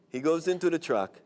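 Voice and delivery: ordinary talk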